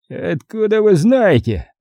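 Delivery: mildly alarmed, high and drawn-out question; agitated. A narrator's reading. Admiral challenging a leak of secret information, mildly agitated. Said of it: "заволновался Купер".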